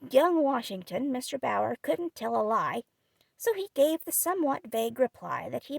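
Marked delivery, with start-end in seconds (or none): none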